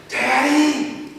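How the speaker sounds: a small son calling out in the dark; child calling daddy. Retold or imitated. Son wakes dad at 5 AM.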